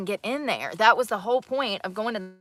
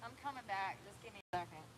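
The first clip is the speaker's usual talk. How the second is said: far from the mic; 21 dB quieter than usual. Real, not acted. Walked away from the phone.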